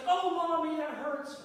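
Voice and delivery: high, loud whine calling for mommy; whiny child. Retold or imitated. Boy in the fable crying to his mother for help, high whine. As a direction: whimper for mommy to come running.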